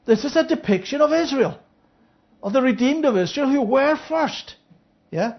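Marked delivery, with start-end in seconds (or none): none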